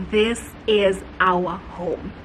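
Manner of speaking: low and very slow, word by word; slow, weighty realisation. Retold or imitated. Couple walking into the house, slow and certain. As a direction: stretch out each word of the verdict. Couple's verdict, said slowly then repeated.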